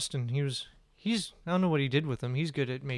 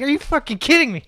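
exasperated shout; 12 semitones higher, 11 dB louder, faster than usual. Retold or imitated. Comic exasperation at heckler.

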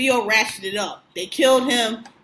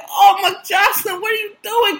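shocked exclaim; 8 semitones higher, 4 dB louder than usual. Retold or imitated. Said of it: "I was like".

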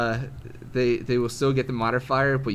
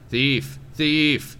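shouting; 5 semitones higher, 5 dB louder than usual. Acted. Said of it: "He turns around and screams".

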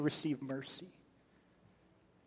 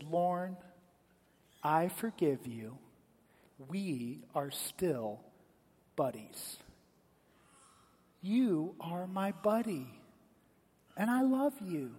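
soft, slow reassurance with long pauses; gently reassure, letting each phrase land; tender forgiveness; 4 dB quieter, slower than usual. Retold or imitated. Missus McManus forgives the boy who hit her, tender and slow. Acted forgiveness of the crying child.

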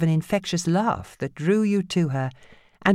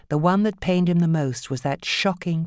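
ordinary delivery

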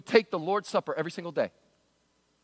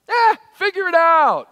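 loud, high, careless brush-off; dismissive; 11 semitones higher, 11 dB louder than usual. Acted. Preacher mimicking people shrugging off a serious warning, dismissive. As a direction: shout it off like it's no big deal.

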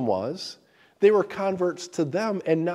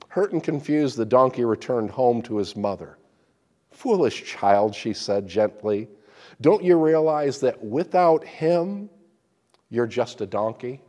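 lively, louder storytelling read; story narration; 6 dB louder than usual. Acted. Preacher reading a donkey fable aloud, dramatic.

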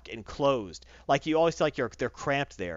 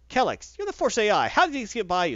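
high, louder mock scolding; acted exasperation, pitched up; indignant demand; 8 semitones higher, 5 dB louder than usual. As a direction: demand an answer in raised pitch.

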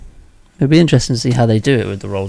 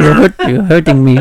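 Indian butler voice; 6 semitones higher, 7 dB louder than usual. Retold or imitated. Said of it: "make him sound like an Indian butler".

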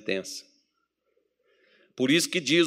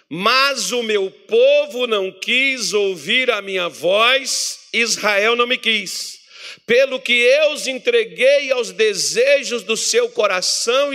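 higher, louder, slow scripture read; preached scripture reading; 6 semitones higher, 4 dB louder, slower than usual. Acted. Pastor reads the Psalm to the congregation, preaching. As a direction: proclaim the verse to the church, slowly.

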